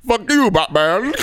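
mock tough villain. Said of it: "just said".

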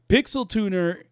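usual speaking voice

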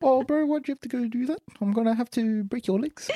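put-on high-pitched, gentle Kiwi accent; New Zealand bouncer. Bouncer threatening to break a bro's legs, high New Zealand accent. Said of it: "the bouncer New Zealander".

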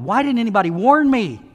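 retold exasperated outburst. Angry driver after crash.

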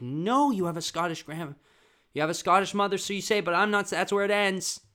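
high, loud acted arguing; loud protest. Child arguing with dad about Scottish mother, loud protest. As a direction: raise pitch and volume to argue back. Retold argument with dad over pronunciation.

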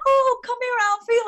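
high, loud coo to the dog Alfie; sweet high coo to Alfie; doting on the dog. Real, not acted. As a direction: call the little dog over with delight. Cuddling her old chihuahua.

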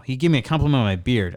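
ordinary talk

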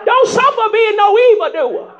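usual speaking voice

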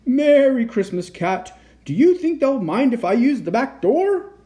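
high, loud, booming greeting; boisterous Santa. A narrator's reading. Santa greeting a friendly cat, loud and hearty. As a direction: call out as a noisy Santa.

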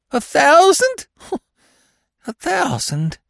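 high, stunned questioning; dazed, high-voiced wonder. A narrator's reading. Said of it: "he muttered in a dazed fashion".

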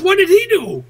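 high-pitched mock disbelief; retold astonishment. Irish bar patron baffled by a late hit, high astonished question. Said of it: "He says".

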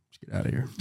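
urgent whispered retreat. Retold or imitated. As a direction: caveman whispering to flee. Retold caveman fleeing a snake.

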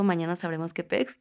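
ordinary talk, nothing marked

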